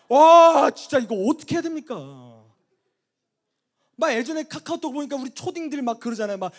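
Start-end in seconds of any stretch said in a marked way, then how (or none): 0.1-2.4 loud, high-pitched exasperation; exasperated outburst. Real, not acted. Preacher complaining about kids trampling spilled popcorn, exasperated. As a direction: cry out at your wit's end.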